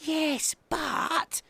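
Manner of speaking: put-on high baby owl voice; little owl attempting a but; trying to protest. A narrator's reading. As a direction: start a squeaky objection. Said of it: "tried Baby Owl".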